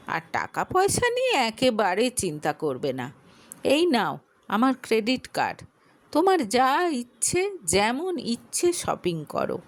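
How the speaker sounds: ordinary delivery, nothing marked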